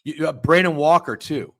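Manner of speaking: mocking quote. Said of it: "hearing people defending James Franklin and saying".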